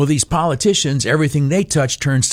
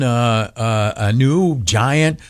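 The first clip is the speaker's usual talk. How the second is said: ordinary talk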